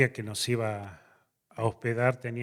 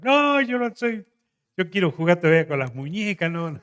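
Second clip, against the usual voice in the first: much higher, louder, mock tearful child; imitating crying daughter; 7 semitones higher, 8 dB louder than usual. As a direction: whimper it like the crying girl. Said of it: "se largó a llorar".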